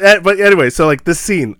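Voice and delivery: normal talking voice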